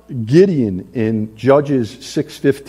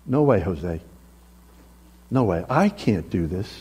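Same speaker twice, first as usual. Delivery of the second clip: timid refusal; 8 semitones lower, 8 dB quieter than usual. Acted. Moses refuses God, scared.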